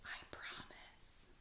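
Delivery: soft whispered promise. A narrator's reading. Larry whispering a promise to Stanley, soft. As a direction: breathe it to him gently. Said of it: "Larry whispered".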